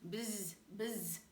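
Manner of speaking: plain reading voice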